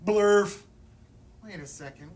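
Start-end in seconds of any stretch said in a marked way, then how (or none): 0.1-0.6 loud silly shout. Acted. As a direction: blurt it out loud. Forced to say nonsense.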